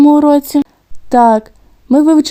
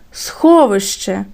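Сховище said slowly, with a long stressed СХО; stressed syllable drawn out; 3 semitones lower, slower than usual. Shown as a demonstration. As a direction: stretch the first syllable of Сховище to show the stress. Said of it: "Промовили це слово вголос".

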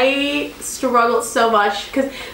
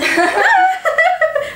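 high burst of laughter; 3 dB louder than usual. Real, not acted. Laughing at not being done.

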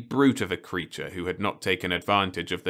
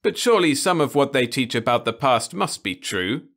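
higher, louder insistence; insisting; 7 semitones higher, 6 dB louder than usual. Acted. Ludovic insists to Osmond, higher and louder. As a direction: press the point. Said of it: "Ludovic insisted".

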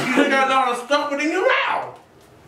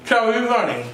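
mouth full; 3 semitones lower, faster than usual. Real, not acted. Chubby bunny said through marshmallows.

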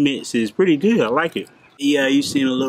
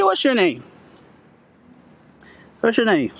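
high pet voice; 6 semitones higher than usual. Real, not acted. Asking the cat.

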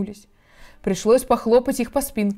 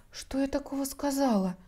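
small, soft, higher voice; bewildered; 6 semitones higher, 9 dB quieter than usual. A narrator's reading. Alice wonders what she said wrong, softly bewildered. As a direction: wonder helplessly, almost to yourself. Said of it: "растерялась Алиса".

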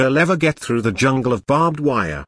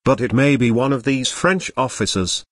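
usual speaking voice

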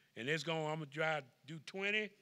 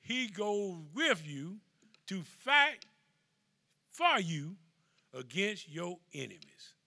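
preaching at full force; 2 semitones higher, 5 dB louder, slower than usual. Acted. Preacher rallying the flock against their enemies, loud. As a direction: shout fight to rouse them. Peak of sermon.

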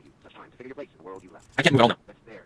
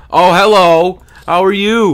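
mock greeting; 8 semitones higher, 11 dB louder, slower than usual. Mocking greeting to monster.

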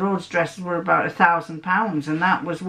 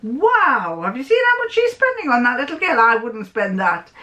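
higher, fast amazed gossip; 5 semitones higher than usual. Retold or imitated. Narrator marveling to friends about the spending, high. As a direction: marvel at her spending, pitched up. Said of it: "because of".

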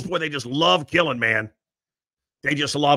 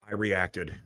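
ordinary talk, nothing marked

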